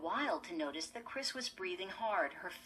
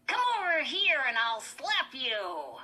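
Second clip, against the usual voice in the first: high, loud squeaky dummy threat; Slappy; 7 semitones higher, 9 dB louder than usual. A narrator's reading. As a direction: threaten through motionless lips like a puppet. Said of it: "Lindy made him say".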